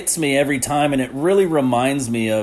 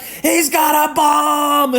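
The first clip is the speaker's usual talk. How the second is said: very high, slow, alarmed cry; panicked passengers; 4 dB louder, much slower than usual. Retold or imitated. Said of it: "they're like".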